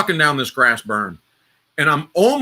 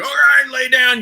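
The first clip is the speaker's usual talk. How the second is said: barked order, pitched up high and louder; retold drill yell; 10 semitones higher, 4 dB louder than usual. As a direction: bark it like a drill sergeant at the berm. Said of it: "because they're screaming and yelling at you".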